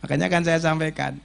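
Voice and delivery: ordinary talk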